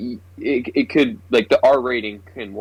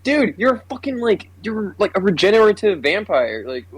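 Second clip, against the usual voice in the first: high-pitched, stammering mock disbelief; retold incredulity; 9 semitones higher than usual. Viewer mocking a gun pulled on a vampire, retold incredulity. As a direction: question it as if utterly baffled, pitched up.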